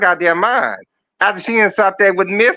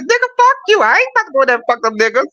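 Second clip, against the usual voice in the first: high, fast, defiant mock woman's voice; 6 semitones higher, much faster than usual. [name] refusing [name] in the hallway, high and fast mocking. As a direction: snap back at him in a shrill-free but high squeal. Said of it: "And she said".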